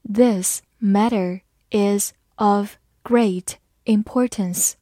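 slow, word by word; slow read-along. Shown as a demonstration. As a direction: read it slowly, pausing after each word. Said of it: "慢慢来读". (慢慢逐词朗读)

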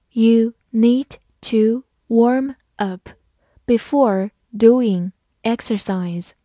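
"You need to warm up" word by word; slow reading. Shown as a demonstration. Teacher models the warm-up sentence for learners, slow reading. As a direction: read slowly, a clear gap after each word. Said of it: "好，慢读一遍".

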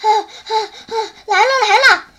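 put-on panting, stammering child; out of breath. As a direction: gasp it out after running. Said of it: "雨来呼哧呼哧喘着气". (气喘吁吁地说)

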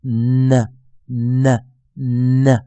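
"呢" as a held N released into a schwa; isolated, drawn-out N sound on "呢"; stressed dark N. Shown as a demonstration. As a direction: model "呢" as a heavy "nnn-uh". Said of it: "重读出来".

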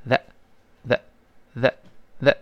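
the with voiced th brushed off the upper teeth; isolated voiced th in the; tongue-between-teeth th. Shown as a demonstration. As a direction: repeat the slowly as separate drill sounds. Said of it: "用舌的尖尖跟上牙蹭一下".

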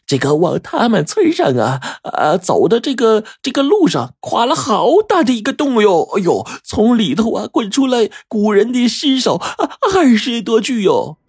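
high, stammering village gossip; rambling landlady. A narrator's reading. Landlady recounting rains, landslide and ancient corpses, rambling high voice. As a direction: build from halting fillers to high-pitched wonder. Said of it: "然后又说".

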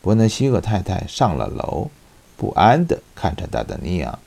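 ordinary talk

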